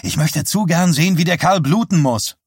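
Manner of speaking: put-on high, eager teenage urging. A narrator's reading. Teenager wanting the showman to pay, eager urging. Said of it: "drängte der Teenager".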